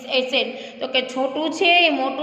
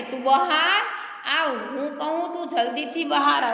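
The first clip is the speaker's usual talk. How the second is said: ordinary talk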